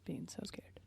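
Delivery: breathy whisper, no voice at all; mock-scared whisper. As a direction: whisper it like you're hiding.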